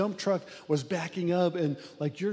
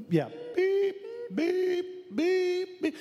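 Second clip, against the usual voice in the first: high piercing beep beep imitation; reversing truck beeps; 14 semitones higher, much slower than usual. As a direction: mimic a truck backing up, high. Imitating dump truck backing up.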